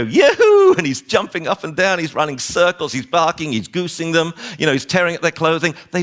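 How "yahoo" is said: yelped high and loud; a wild whoop of freedom; crazy dog joy. Retold or imitated. Dog bursting into the park, whooping high and loud. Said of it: "Like, you know".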